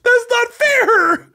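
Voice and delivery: high squeaky voice, sobbing with laughter; crying with laughter. Real, not acted. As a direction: laugh so hard the voice cracks up high. Host crying with laughter, high-pitched.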